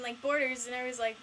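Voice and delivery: normal talking voice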